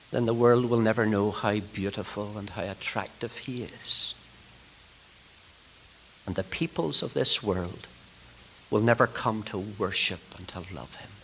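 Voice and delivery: low, soft and reverent; hushed solemn preaching. Real, not acted. Preacher warning ministers the world will never worship, hushed. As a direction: let the warning sink quietly.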